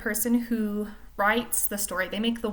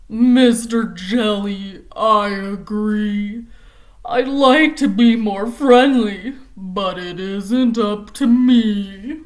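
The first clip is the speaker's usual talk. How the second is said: slow, louder, mopey fish voice; gloomy pout fish; 10 dB louder, slower than usual. Acted. The pout fish answers Mister Jelly, slow and mopey. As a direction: sigh it out, dragging each word.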